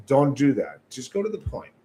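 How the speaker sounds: usual speaking voice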